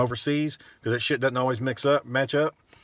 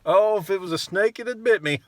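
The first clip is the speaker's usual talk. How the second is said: pitched-up smug joker voice; mock customer joke; 7 semitones higher than usual. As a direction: parody a lame customer quip. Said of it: "customer... lame little jokes".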